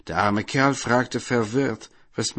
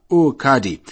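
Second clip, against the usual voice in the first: raised, louder appeal; calling out; 4 semitones higher, 7 dB louder than usual. A narrator's reading. Villager to the Kadi pleading for a man, calling out. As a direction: call out to the judge. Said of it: "Da rief einer der Dorfbewohner".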